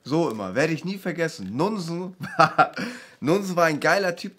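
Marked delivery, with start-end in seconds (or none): 2.2-3.2 burst of laughter. Real, not acted. Laughing at boxing memory.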